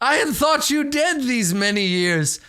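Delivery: mock courtly greeting. GM's NPC greets Blackstar with hammed-up courtesy. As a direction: ham up the fawning lord. GM voicing Thavius mockingly.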